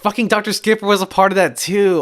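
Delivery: loud, high-pitched outburst of remembering; exasperated realisation. Real, not acted. Co-host remembering Doctor Skipper was involved, loudly exasperated. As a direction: blurt it out high as it suddenly comes back.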